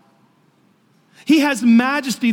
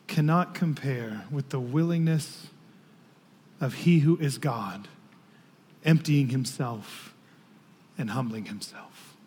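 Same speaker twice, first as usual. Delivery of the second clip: low, soft, measured sermon delivery; hushed preaching; 9 semitones lower, 9 dB quieter than usual. Real, not acted. Preacher to congregation on the Son of God becoming a child, hushed. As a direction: drop down low and quiet, phrase by phrase.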